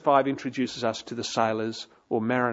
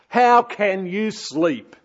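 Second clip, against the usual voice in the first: slow, louder, higher incredulous question; captain's question; 5 semitones higher, 5 dB louder, much slower than usual. Retold or imitated. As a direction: drag out the captain's disbelief. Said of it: "He says".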